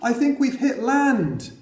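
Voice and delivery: put-on girl shouting in excitement on waking; excited shout. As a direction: shout the news to the others. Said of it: "Amy shouted".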